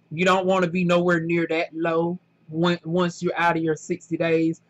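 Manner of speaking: normal talking voice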